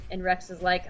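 normal talking voice

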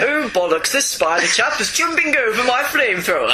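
put-on indignant old-chap parody; mock upper-crust British. Co-host joining in on the villain's voice, mock upper-crust British. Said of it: "kind of voice, can't you?".

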